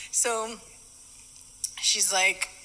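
normal talking voice

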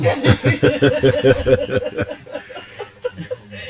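long, high burst of laughter; hearty laugh. Real, not acted. Co-host laughs at the remark.

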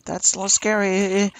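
ordinary talk

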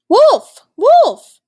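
a long, high, loud call across the mountain; a boy's loud, high hollering call. A narrator's reading. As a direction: shout Wolf! Wolf! to be heard far away. Boy calling out from afar.

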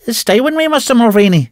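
high, pleading woman's voice; cling to him with your voice; Annie's plea. A narrator's reading. Annie begging Mulvaney not to leave, high pleading. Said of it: "says she".

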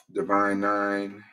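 ordinary talk, nothing marked

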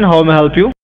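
usual speaking voice

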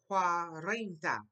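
Cuarenta with the r rolled, said slowly; Cuarenta, slow, tongue rolling the r. Shown as a demonstration. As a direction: roll the r in Cuarenta. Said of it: "your tongue rolls a little bit".

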